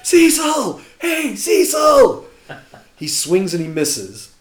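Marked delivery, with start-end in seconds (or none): 0.0-2.2 high, loud, drawn-out "SEE-sil" jeer; imitated heckling yell. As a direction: holler the name slowly like a taunting fan. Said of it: "we're yelling at the top of our lungs".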